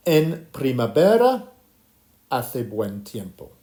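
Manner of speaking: teacher's slow, deliberate demonstration; slow language model. Shown as a demonstration. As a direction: model it slowly for learners, pitch dropping in the second half. Teacher models phrase for class repetition.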